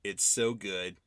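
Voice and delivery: normal talking voice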